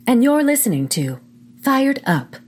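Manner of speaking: stiff robotic announcer voice. Acted. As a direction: speak like a mechanical robot. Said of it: "I can't talk like that anymore".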